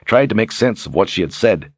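plain reading voice

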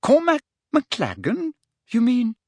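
high, slow, incredulous question; astonished. A narrator's reading. Parvati checking whom Hermione is dating, astonished. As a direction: pitch it way up in disbelief.

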